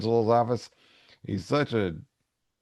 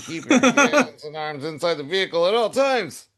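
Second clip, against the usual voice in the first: higher, louder amusement ride announcer voice; mock ride announcer; 8 semitones higher, 6 dB louder than usual. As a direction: announce it like a theme park ride operator.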